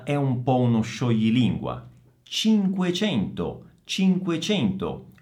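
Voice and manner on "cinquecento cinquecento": high, deliberate CINQUECENTO, both C as ch; careful C sounds. Shown as a demonstration. Teacher models a tongue twister, careful ch sounds in CINQUECENTO. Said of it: "Occhio in modo particolare al suono delle C".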